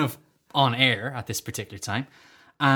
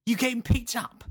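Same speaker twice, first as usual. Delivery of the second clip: higher, softer imitated question; put-on matey voice, raised and soft; Terry Venables; 6 semitones higher, 5 dB quieter than usual. Man asking a supposed acquaintance about his lift, high soft impression. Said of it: "I'm walking with Terry Venables".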